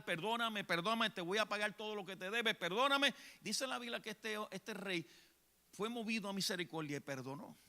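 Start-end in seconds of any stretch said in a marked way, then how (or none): none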